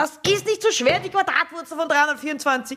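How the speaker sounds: high-pitched exasperated teacher rant; mock yelling at pupils. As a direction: play a teacher losing it with the class, punching out words. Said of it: "ich würd die Schüler anschreien".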